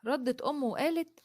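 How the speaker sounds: plain reading voice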